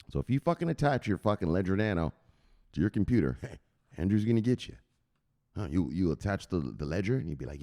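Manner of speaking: ordinary talk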